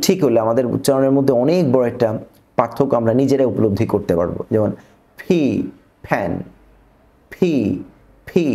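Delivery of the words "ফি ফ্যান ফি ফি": ফি and ফ্যান with a burst of air; aspirated p. Shown as a demonstration. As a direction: puff air on the p. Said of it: "জোরে একটা বাতাস ছাড়ব". (ফি, জোরে বাতাস ছেড়ে)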